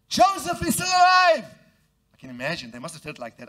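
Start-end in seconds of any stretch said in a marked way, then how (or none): 0.1-1.4 high, loud, breathless announcement; excited news. Acted. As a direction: burst out with the good news. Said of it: "They told him saying".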